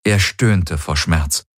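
ordinary delivery, nothing marked

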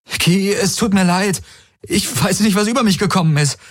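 high, pleading rush of words; begging her to stop and listen; desperate apology. Acted. Galen chases Kirai, pleading breathlessly.

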